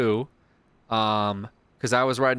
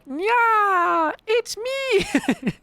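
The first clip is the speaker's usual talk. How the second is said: very high squeaky catgirl; cat lady voice; 4 dB louder, much slower than usual. Retold or imitated. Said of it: "Momo will call as Feline Lady".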